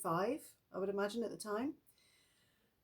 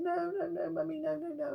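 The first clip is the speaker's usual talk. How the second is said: put-on high, tearful pleading child voice; a mother acting out her daughter crying no; imitating child crying; 8 semitones higher than usual. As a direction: copy a sobbing little girl. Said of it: "she was just crying".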